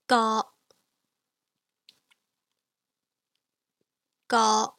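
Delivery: Ga held steady in the middle; mid level tone. Shown as a demonstration. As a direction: hold Ga level mid-range. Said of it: "in the middle of your vocal range".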